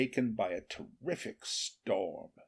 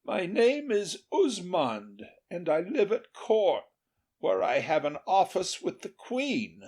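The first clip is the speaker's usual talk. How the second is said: lightly raised king's voice; king in disguise; 7 semitones higher than usual. A narrator's reading. Disguised king lightly voices his false identity. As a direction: give a false name smoothly. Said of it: "replied Charlemagne".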